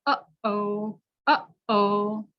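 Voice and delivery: "Uh-oh" with a catch in the throat between the vowels; "Uh-oh" cut by a throat stop; glottal uh-oh. Shown as a demonstration. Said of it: "let's practice saying".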